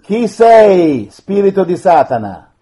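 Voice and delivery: loud, stern command; commanding exorcist. Real, not acted. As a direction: confront the demon. Priest commanding a spirit.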